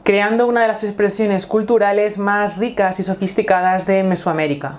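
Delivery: epic voiceover opening a film; nature documentary. Acted. Dramatic intro over music.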